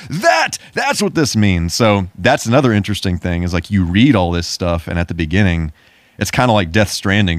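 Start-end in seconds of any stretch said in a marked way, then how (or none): 0.1-1.7 high, slow dawning aha; high, slow, amazed oh of discovery; mock aha realization. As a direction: act out the lightbulb moment, drawn out. Gamer's realization about game lore.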